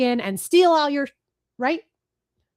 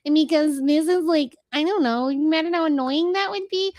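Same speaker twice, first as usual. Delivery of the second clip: higher put-on playful voice; silly nanny voice; 5 semitones higher than usual. Shown as a demonstration. Former nanny shows favorite silly voice, higher put-on. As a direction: put on an annoying kiddie-play voice. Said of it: "where I'm talking like this".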